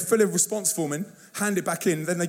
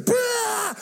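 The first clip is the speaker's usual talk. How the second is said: sudden high shouted bah of excitement; excited yelp. Retold or imitated. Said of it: "Mister Entrepreneur A D H D goes".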